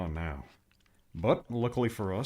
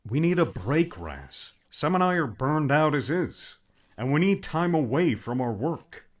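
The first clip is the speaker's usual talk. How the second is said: insistent; 5 semitones higher, 5 dB louder than usual. Acted. Pleading argument with Raz.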